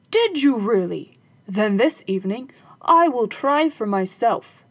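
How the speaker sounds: believe it and plan eagerly; eager robber. Acted. Robber eager to try the guitar himself, eager.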